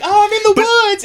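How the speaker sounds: put-on high, silly frolicking-girl voice; hammed-up high-pitched innocent; mock damsel. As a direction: squeal it like a ditzy video heroine. Mimicking girl in music video.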